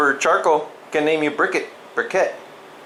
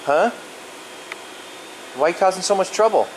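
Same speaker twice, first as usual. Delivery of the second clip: ordinary delivery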